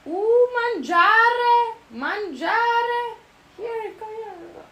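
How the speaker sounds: high, drawn-out sing-song coaxing; coaxing call. Real, not acted. Calling the cat over to eat, coaxing. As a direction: coo it up high, stretching the vowels.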